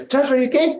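high-pitched call to check on someone; worried check. Retold or imitated. Acts out checking on Josh.

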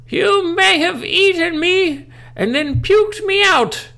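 very high, loud, slow shriek; furious granny. Acted. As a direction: scream it like a vengeful ghost. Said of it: "my grandmother said".

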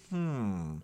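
low, slow, soft musing; acted thoughtful puzzlement, hushed and unhurried; puzzled pondering. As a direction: muse quietly as if to yourself. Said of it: "questions that just make you say".

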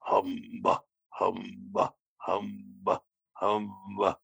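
low monster growl. Pretend. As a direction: growl each humba like a monster. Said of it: "use your monster voice".

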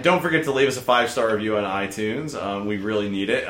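ordinary delivery

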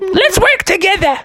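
high falsetto cartoon mother; high, squeaky mom voice; Froggy's mom. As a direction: pitch it way up, eager to team up. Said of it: "She said".

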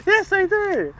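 very high, loud acted reply; mock enthusiasm. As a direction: squeal it in exaggerated delight. Acted excited reply in retold exchange.

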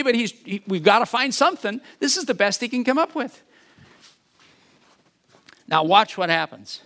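ordinary delivery

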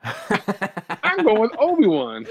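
laughing high and slow. Retold or imitated. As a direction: say it through a laugh. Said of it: "you're like".

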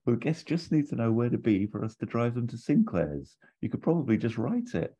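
high, bright-edged imitation of Sybil scolding; voicing Sybil. Wife lecturing Basil about the sign, mock high voice. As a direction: play the wife, high and tart, lecturing her husband. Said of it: "and Sybil says".

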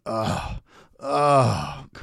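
loud, drawn-out groan; demonstrated groan. Shown as a demonstration. Preacher showing listeners what groaning is, demonstrated groans. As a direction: heave an exaggerated groan.

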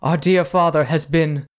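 high and rising to a loud wail on murdered; theatrical grief, building; distraught lady. Acted. Noblewoman tells the cousins of the murder, building to a loud wail. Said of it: "rushes towards you".

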